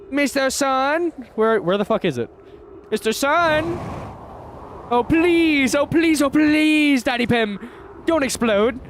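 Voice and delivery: put-on high, childlike begging; mock pleading. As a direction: plead with the sun, high and hammed-up. Jokingly begging the sun.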